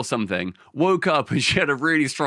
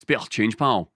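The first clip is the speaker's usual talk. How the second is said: demonstrating a Glaswegian beggar's line; short Glaswegian catchphrase, vowels squeezed; Glaswegian accent; 3 semitones lower, slower than usual. Shown as a demonstration. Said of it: "lock you into the Glaswegian accent".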